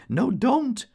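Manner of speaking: high, loud protest; a barmaid's loud squeal of refusal; crying out. A narrator's reading. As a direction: shriek it to drown her out. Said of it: "she cried".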